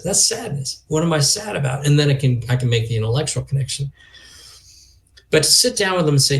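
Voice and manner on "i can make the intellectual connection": ordinary delivery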